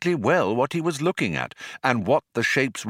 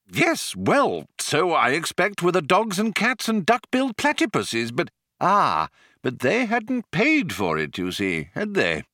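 put-on higher, wavering elderly explainer; old planet-builder, mild; 8 semitones higher than usual. A narrator's reading. Old man justifying the mice to visitor, mild. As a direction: muse aloud like a fussy old man.

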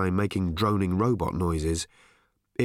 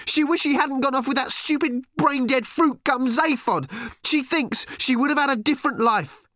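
high, loud tearful tantrum; furious teenage girl; 4 dB louder than usual. A narrator's reading. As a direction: scream it out, close to crying. Random raging at Arthur.

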